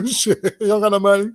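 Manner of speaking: loud, laughing jeer; mocking laugh; laughing taunt. Real, not acted. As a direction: cackle through the words. Laughing taunt about money.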